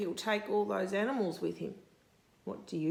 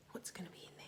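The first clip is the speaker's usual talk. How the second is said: whispered, teasing question; suspense whisper; 17 dB quieter, much faster than usual. Real, not acted. Reading to a child, whispering before the turtle appears. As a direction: hush them before the reveal.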